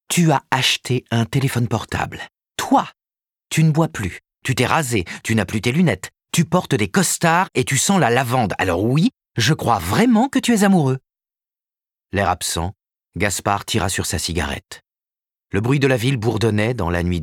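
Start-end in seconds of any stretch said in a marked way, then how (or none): 0.1-11.0 higher, building amazed list; lightly voiced Karen, incredulous. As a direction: pile up the evidence, amazed. Karen listing his changes.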